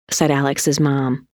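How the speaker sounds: ordinary delivery, nothing marked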